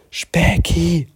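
hushed whisper. Acted. Mats calls the hidden creature, whispering. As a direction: whisper the name secretly. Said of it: "flüsterte er".

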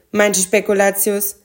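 ordinary delivery

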